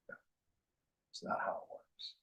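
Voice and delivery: quick unvoiced whisper. Real, not acted. Teacher dismissing deathbed ritual, quick whisper. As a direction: toss it off as a hushed aside. Dry aside to audience.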